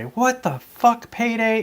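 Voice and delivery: high, drawn-out mimicked swearing; Cordelia. Retelling what she used to say in the car, high mimicry. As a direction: imitate it in a high, stretched squawk. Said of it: "Like Cordelia used to say".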